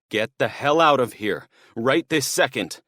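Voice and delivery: a nervous thief trying to sound tough; angry threat. Acted. Burglar threatening the father, angry.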